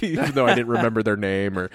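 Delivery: ordinary delivery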